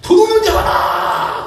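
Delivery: very high, drawn-out shout for help; shouted alarm. Acted. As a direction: holler after a fleeing thief. Acts out chasing crowd calling out. (도둑을 쫓으며 높고 길게 고함치기)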